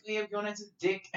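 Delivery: high-pitched mimicry, "dick" for deck; Kiwi vowel shift. Echoing the camp Kiwis, high "dick" for deck. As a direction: pitch it up, make deck's E an I: "dick". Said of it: "anything with an E, it was an I".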